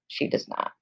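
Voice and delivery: soft unvoiced whisper; a quick whispered correction to the class; whispered aside. Real, not acted. Teacher's aside correcting the quote.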